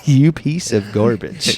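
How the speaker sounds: slow, teasing fake scorn, laughing into it; play-insult him, clearly joking; mock insult. Host teasing his co-host for never watching, mock insult. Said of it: "No, I'm just kidding".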